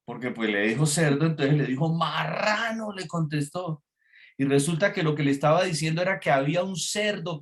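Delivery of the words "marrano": mock yell, stretched slowly; retold shouted retort. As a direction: imitate a driver's long holler. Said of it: "sacó la cara por la ventana y le dijo".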